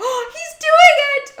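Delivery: high, loud thrilled shout; excited shout. Real, not acted. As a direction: yell out in delight. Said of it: "that was really, really loud".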